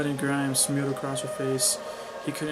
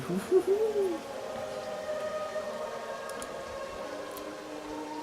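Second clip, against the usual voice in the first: long, high breathy laugh. Real, not acted. Reader laughs at failed accent, high laugh. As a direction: laugh high at your own attempt.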